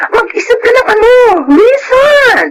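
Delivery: shrill push to think of an excuse; insistent cry. Acted. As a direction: drive the point home up high. Friend insisting she invent a reason.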